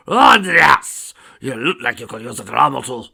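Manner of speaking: loud, hammed-up Scottish brogue. A narrator's reading. Grampus offering a dram, loud Scottish brogue. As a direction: belt out the opening in broad Scottish. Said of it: "his Scottish brogue still present".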